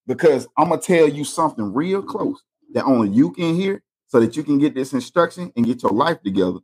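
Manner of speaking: ordinary delivery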